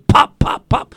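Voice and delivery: loud, punchy popping noises; imitated popping. Imitating bamboo exploding in the fire, loud percussive pops. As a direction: punch out each pop like a small blast.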